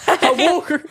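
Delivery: ordinary talk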